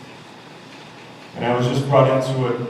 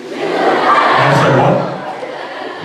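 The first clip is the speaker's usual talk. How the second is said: laughing disbelief; 3 dB louder, much slower than usual. Real, not acted. Baffled by chicken remark.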